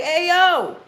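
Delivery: loud high catcall up to a window; mock street holler to a goddess; yelling from the window. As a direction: call her outside like a guy on the street. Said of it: "motherfuckers yelling from the window".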